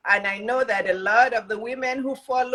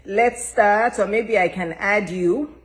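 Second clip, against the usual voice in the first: usual speaking voice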